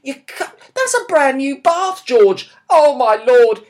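loud, high shocked outburst; shocked shout. Acted. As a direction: shout it out in disbelief at the bath. Dad finds stones in new bath.

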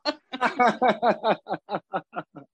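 hearty run of laughter; long, amused chuckling. Real, not acted. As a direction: laugh it out at length. Laughing at own question.

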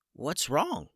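a hurt chicken asks innocently; small chicken voice. Acted. Hurt little chicken asks the shocked wizard, puzzled high voice. Chicken Hummus first speaks.

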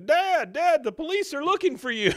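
high, loud panicked yell; screaming. Retold or imitated. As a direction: yell it like a frantic kid. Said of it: "Bobby's over there screaming".

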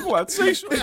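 very high, laughing imitation; mocking a pleading look. Teasing a co-host who stalled, high laughing impression. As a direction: squeak it out like a panicked plea for rescue. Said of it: "you were looking at all three of us going".

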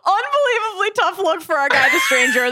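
mock outraged shout. Real, not acted. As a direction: wail it out in mock despair.

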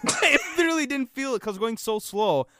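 high, loud, laughing disbelief; amused, incredulous outburst. Real, not acted. Laughs at own snowstorm mishap.

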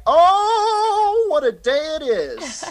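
high, loud, teary with joy; near-tearful delight at the surprise job offer; overjoyed. Pretend. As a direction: gush like your dream came true.

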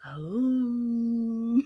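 long, drawn-out "om" hum; mock meditation chant. Host leading a joke moment of silence, mock chant. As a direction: hum a held, rising om.

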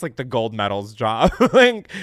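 laughing. Real, not acted. Laughing at the souvenir idea.

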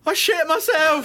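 high-pitched mock drunken yell; drunken confession shout. Man replaying his drunk karaoke tape, mock yelling. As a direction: holler it like a drunk on the mic. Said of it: "And then going".

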